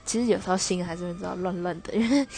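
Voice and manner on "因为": usual speaking voice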